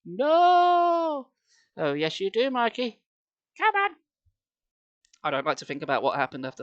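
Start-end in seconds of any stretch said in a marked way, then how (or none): 0.1-1.3 very high, loud long howl; drawn-out toddler howl; wailed no. Acted. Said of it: "I cried". 1.8-3.9 rising to high mother's voice; lightly voiced mum; brisk mum.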